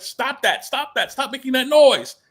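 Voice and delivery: put-on high, urgent scolding as the young squire; scolding squire boy. Squire boy chasing after the dragged heroine, urgent high-pitched scolding. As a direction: imitate a kid loudly hushing someone, pitch jumping up. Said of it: "this little kid, this boy comes chasing behind her, and he's like".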